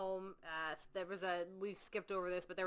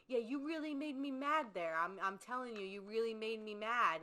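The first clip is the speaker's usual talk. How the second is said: ordinary talk, nothing marked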